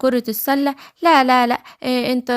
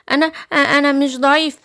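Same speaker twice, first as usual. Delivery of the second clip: stammering cub's denial; nervous little lion. Acted. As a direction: stumble on the first word, then protest as the cub. Little lion stammers back at wolf.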